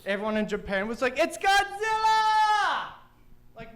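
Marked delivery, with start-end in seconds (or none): 1.2-2.9 high, loud, long drawn-out yell; mock panicked shout. Everyone in Japan spotting Godzilla, hammed-up panicked shout. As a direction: scream it like a monster-movie crowd.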